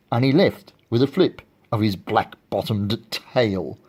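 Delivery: ordinary delivery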